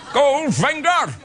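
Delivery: high, slow, hammed-up old man; senior Bond. Comedian imagining Bond at seventy-four, slow high old-man voice. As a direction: draw the words out like a doddery elderly Bond. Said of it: "Senior Bond".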